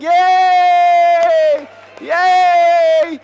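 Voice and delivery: long, high, loud crowd cheer; cheering shout. Acted. Crowd hails Mordecai in the street, cheering shout. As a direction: cheer at full voice, drawing it out. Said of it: "everyone's shouting".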